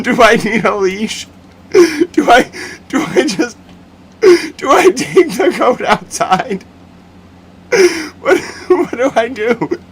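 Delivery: high, squeaky panicked voice breaking into laughter; laughing impression. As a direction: play a flustered staffer while cracking up. Staffer panics over a goat.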